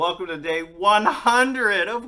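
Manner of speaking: high, very loud whooping announcement; celebratory yell. Real, not acted. Host celebrating day one hundred with viewers, celebratory yell. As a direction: holler it out, stretching words into shrieks.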